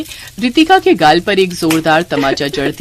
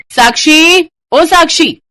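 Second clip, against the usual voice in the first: high, loud call across the house; 9 semitones higher, 7 dB louder, slower than usual. Acted. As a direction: call her from afar. (ज़ोर से पुकारते हुए)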